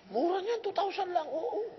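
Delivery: lightly voiced high retelling; high pitched retold reply; high mock quote. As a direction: squeak it up in mock surprise. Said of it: "[name] retold".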